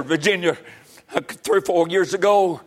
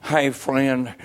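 usual speaking voice

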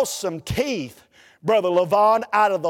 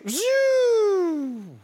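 very high, long whining zoo that dies away; starter whine; 12 semitones higher than usual. Retold or imitated. Preacher imitating the failed start in the motel lot. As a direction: sound the starter spinning without catching. Hit the switch, starter fails.

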